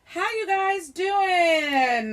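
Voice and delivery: high, very drawn-out mock greeting; silly greeting. Host asking listeners who can't answer, silly drawn-out call.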